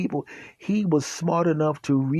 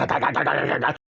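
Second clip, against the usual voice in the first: fast chattering bark noise; imitated dog barking. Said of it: "making that sound".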